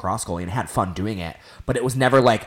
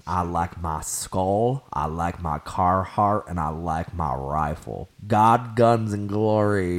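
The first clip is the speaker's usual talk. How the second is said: slow, lowered mock country-man drawl; country twang; slower than usual. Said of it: "You added a country twang".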